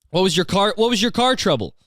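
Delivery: normal talking voice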